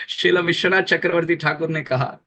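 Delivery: usual speaking voice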